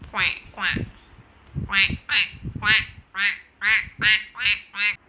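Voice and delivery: loud repeated quacking; duck quacking. Retold or imitated. Imitating ducks for the viewers, loud quacks.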